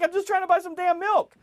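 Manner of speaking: high, loud exasperated outburst; exasperated retort. Retold or imitated. Snapping back at impatient onlookers.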